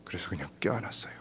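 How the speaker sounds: soft unvoiced recollection; hushed whisper. Real, not acted. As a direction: remember it softly. Tender memory, whispered. (속삭이듯 조용히)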